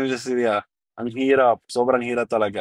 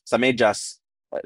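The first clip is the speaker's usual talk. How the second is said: usual speaking voice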